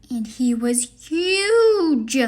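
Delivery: a big, slow, awed exclaim; dramatic slow exclaim. Acted. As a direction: make him sound enormous. Sees huge monster.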